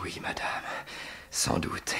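hushed, whispered assent; stage seducer dropping to a whisper; Tartuffe. Acted. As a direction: murmur it intimately, with a pause. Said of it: "je rêve de jouer Tartuffe".

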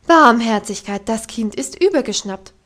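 loud shocked exclamation; horrified cry. Acted. Governess crying out that the child has lost her mind, loud horror. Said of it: "rief Fräulein Rottenmeier aus".

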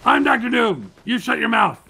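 hammed-up villain announcement; mock villain outburst; Doctor Doom. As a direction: declare it high and imperious. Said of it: "That's how he talks".

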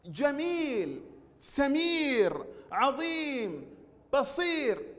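جميل, سمير, عظيم, بصير very slowly, each ii held; long ii vowels. Shown as a demonstration. Teacher listing words with yaa madd, drawn-out vowels. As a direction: stretch the last syllable of جميل and each word after. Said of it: "كذلك من الكلمات".

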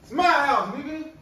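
high, loud furious yelling; angry yelling. Acted. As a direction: scream at him for the money. Friend yelling, throwing him out.

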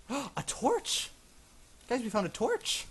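high gasp, then delighted exclamation; high-pitched excited squeal of words; excited discovery. Real, not acted. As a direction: announce the find, thrilled. Thrilled to find a torch.

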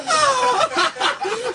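helpless high laughing; shocked laughter. Real, not acted. [name] reacts to Jonathan's blurted indiscretion, shocked laughing.

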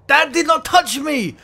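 slow, loud and high protest; indignant yell. Real, not acted. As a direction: shout it in disbelief. Protesting a missed hit.

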